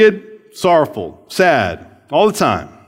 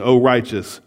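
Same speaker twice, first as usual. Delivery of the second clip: normal talking voice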